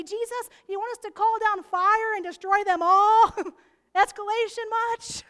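ticked disciples. Retold or imitated. Disciples shouting at Jesus, loud mock outrage. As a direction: blast it like furious disciples. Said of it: "The disciples are ticked".

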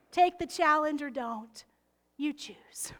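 ordinary delivery

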